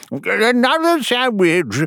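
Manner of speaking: high, slow demand; hammed-up hungry king; greedy king. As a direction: draw it out in a high royal wail. Greedy king demands more food.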